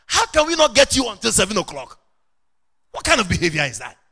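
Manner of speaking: loud, indignant retold rebuke; scolding. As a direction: reenact telling off a junior pastor. Retells scolding a pastor.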